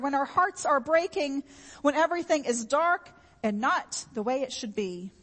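normal talking voice

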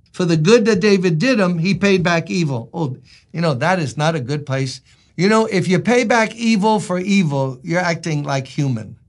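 normal talking voice

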